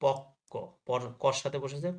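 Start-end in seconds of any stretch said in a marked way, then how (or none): 0.0-0.5 পক্ক drawn out as pok-ko, held before the second k; doubled ক. Shown as a demonstration. Teacher shows students the doubling, পক্ক as pok-ko. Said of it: "ক double হয়ে গেছে".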